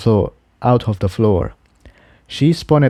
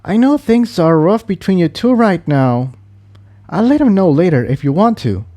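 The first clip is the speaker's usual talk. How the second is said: raised character voice; lightly voiced gentle mare; 8 semitones higher than usual. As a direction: Incendia consoling Tiptoe.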